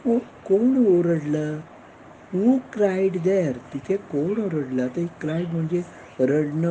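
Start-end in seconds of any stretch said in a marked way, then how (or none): 2.3-3.5 very slow and higher; slow model question. Shown as a demonstration. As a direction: model it slowly for beginners. Teacher models English question. (शिक्षक प्रश्न शिकवतो, अतिशय हळू)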